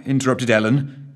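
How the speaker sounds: ordinary delivery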